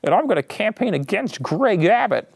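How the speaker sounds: mock mayor. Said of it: "He's now saying he wants to".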